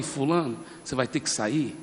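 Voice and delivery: low, softened order; calm, hushed command, pitch dropped; very quiet command. Retold or imitated. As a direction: retell it softly and low. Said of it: "eu falei bem baixinho, bem baixinho".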